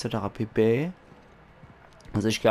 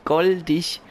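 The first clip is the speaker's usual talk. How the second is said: higher, a bit brighter coo on "goldig"; delighted coo of "goldig", pitched up; 6 semitones higher than usual. Real, not acted. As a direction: coo "goldig" at the cute little animal. Cooing at a cute lemur.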